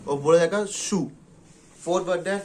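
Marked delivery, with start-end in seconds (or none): 0.7-1.1 सु said as plain "su", no t sound; silent t. Shown as a demonstration. A teacher showing students that सु drops the t. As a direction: drop the t and say सु as su. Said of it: "इसमें टी silent होएगा".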